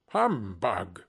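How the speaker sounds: low, grumbling dismissal; dismissive scoff. A narrator's reading. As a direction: brush it off in a low voice. Scrooge scoffs at Christmas greeting.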